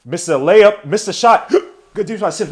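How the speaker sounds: talking through hiccups; hiccuping mid-sentence. Real, not acted. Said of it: "Why the hell am I hiccuping".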